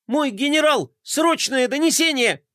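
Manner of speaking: high, panicked shriek; panicked squeal. Acted. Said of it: "панически заверещал он".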